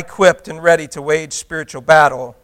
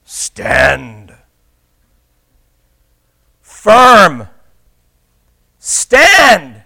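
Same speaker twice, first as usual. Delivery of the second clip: building command; 8 dB louder, much slower than usual. Real, not acted. Preacher ordering the church to stand, building command. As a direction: build each word into a ringing order.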